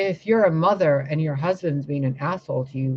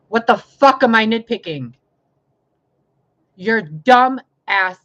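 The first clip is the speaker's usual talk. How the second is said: louder, higher furious demand; angry outburst; 6 semitones higher, 8 dB louder than usual. Real, not acted. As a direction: blast the question at the critics. Ranting at her critics.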